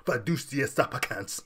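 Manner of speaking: Fiducia Supplicans in an exaggerated foreign accent; mock Latinate accent. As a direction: overdo each syllable with a showy Latinate accent. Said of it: "they accentuate certain, uh, the syllables of it".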